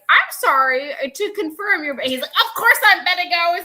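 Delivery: hammed-up radio call; acted phone call. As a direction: play both sides loudly and high. Acting out journalist and bettor.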